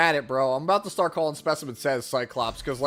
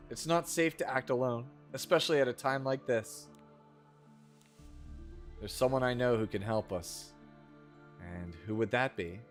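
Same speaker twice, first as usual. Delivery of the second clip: bad acting; 5 semitones lower, 6 dB quieter than usual. Reads game dialogue aloud.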